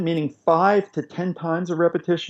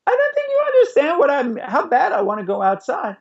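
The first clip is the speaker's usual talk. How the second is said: put-on much higher whiny kid voice; frustrated child; 6 semitones higher than usual. Toddler pushing back at a parent who missed the point, high whiny kid voice. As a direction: play a toddler who feels unheard. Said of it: "your child's gonna go".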